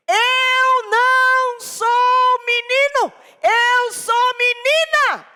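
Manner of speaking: put-on high, loud, drawn-out childish chant; child's singsong declaration. Boy chanting to his mother, very high and slow. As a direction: stretch every word like a defiant child.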